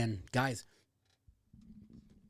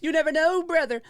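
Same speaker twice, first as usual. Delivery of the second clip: hyped catchphrase; 6 dB louder than usual. Acted.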